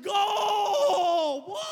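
screaming goal, high and loud; excited goal shout. Retold or imitated. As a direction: roar it like a hockey fan at the arena. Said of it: "I got excited".

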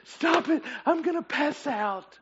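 high, helpless begging through mock hysterics; acted gasping for air; gasping hilarity. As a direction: plead as if too amused to breathe. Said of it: "gasping for air".

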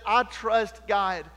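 slow, deliberate proclaiming; slow declaration. Acted. Preacher voicing the cut board, slow and deliberate. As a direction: lay each word down slowly.

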